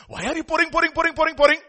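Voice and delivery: high, insistent mimicked Indian English accent; lab instructor's accent. Lab instructor scolds a student at the burette, imitated accent. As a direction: imitate the instructor's accented, rapid-fire "pouring". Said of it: "he's in his own English".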